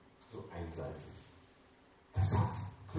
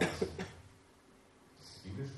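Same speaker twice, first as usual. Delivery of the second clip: sarcastic aside; 9 dB quieter, much slower than usual. Acted. Ironic aside after false claim.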